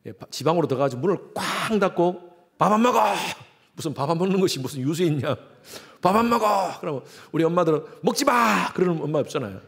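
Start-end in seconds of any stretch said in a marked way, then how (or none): 2.6-3.1 louder, higher sulky shout; I won't eat, shouted; teen yelling back. Retold or imitated. Sulking teen yells at parent. 6.0-6.8 I won't eat, shouted; teen yelling back. Retold or imitated. Sulking teen yells at parent. 8.0-8.7 higher, sharp retort; mock mother snapping. Imagined mom snapping back.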